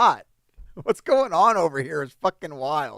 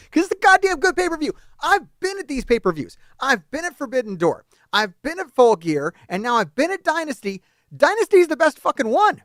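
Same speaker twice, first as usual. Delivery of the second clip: high, louder insistent ranting; real fired-up defense; heated rant; 8 semitones higher, 4 dB louder than usual. Real, not acted. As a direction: hammer each pay-per-view home. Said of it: "I'm too fucking mad".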